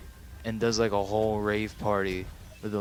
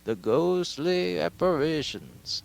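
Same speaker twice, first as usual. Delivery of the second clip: high, slow spooky voice; mock-eerie wail, stretched out high; ghostly; 7 semitones higher, slower than usual. Narrator announcing the ghost voices Rey hears, hammed-up ghostly. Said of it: "she starts having voices".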